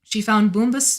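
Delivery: ordinary delivery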